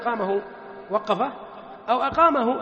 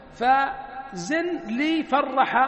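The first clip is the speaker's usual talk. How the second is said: slow teaching; 3 semitones higher, much slower than usual. Shown as a demonstration. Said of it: "weighing the word for students".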